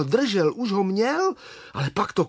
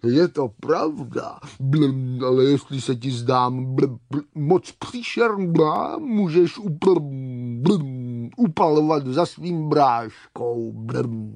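slow burbling voice with blubs; bubbling water creature; 3 semitones lower than usual. Acted. Water scorpion offers Čmelda to run off, bubbling. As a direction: burble between the words like pond water.

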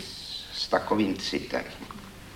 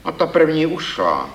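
stern verdict, pitched up and louder; 9 semitones higher, 4 dB louder than usual. Acted. Critic judging a young poet's verses, sternly. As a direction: lay down the judgment firmly. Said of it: "pravil jsem přísně".

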